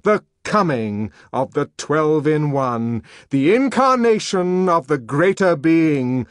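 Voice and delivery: loud, portentous proclamation; grand, emphatic heralding; booming announcement. A narrator's reading. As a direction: boom it out, building to the last phrase. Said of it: "a great synthetic bass boomed out".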